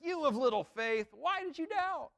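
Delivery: a stern, angry Jesus rebuking Peter; ticked-off scolding. Pretend. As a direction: scold him like you're fed up. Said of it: "scolding him, or is he really ticked at him?".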